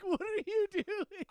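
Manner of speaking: high, loud, slow shriek of alarm; a patient shrieking at the nurse; panicked yell. Retold or imitated. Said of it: "I'm like".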